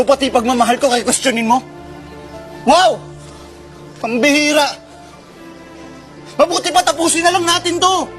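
loud, fed-up breakup line; angry outburst. Acted. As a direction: throw it out loudly, done arguing. Acted couple quarrel, breaking up. (galit at malakas na pagsuko)

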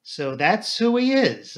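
slow, drawn-out dawning recognition; slow realization. A narrator's reading. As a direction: let it sink in slowly. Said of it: "I said slowly".

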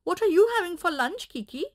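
hungry puppy asking the donkey; eager puppy voice. Acted. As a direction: rattle it off like an eager pup. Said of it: "called Woofus".

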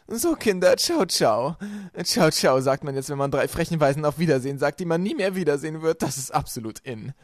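very loud, high gloating farewell; mocking villainess. A narrator's reading. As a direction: taunt them with a loud goodbye. Villainess says mocking goodbye. (höhnisch, sehr laut und hoch)

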